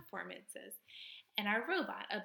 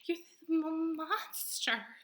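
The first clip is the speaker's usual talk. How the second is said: higher, louder, startled stammer; frightened possum; 8 semitones higher, 4 dB louder, much slower than usual. Acted. Possum afraid of the robot, louder and higher. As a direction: back away in fear.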